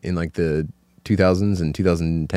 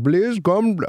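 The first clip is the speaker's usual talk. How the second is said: imitated Indian accent; 12 semitones higher than usual. Imitates the Indian store owner.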